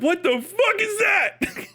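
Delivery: high-pitched, drawn-out incredulity; mock disbelief. Listener reacting to North Irish accent, high mock bafflement. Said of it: "and you're like".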